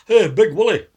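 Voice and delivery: loud, high mock hail; shouting across the pub. Pub regulars hail Big Wally, loud high call. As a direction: bellow it across the bar. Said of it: "They would laugh at something like that".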